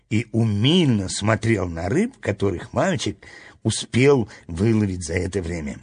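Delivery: plain reading voice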